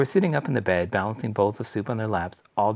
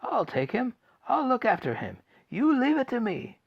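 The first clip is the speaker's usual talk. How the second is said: put-on high, eager old man's voice; excited old grandfather. Grandpa Joe claiming the trip, high eager voice. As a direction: insist like a thrilled old man.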